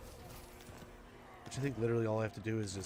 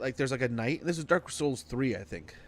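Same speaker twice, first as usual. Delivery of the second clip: ordinary delivery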